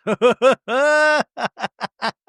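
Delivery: high, drawn-out mock laugh; hammed-up laugh. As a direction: let out a long, high fake laugh. Hammed-up laugh after acted pious quote.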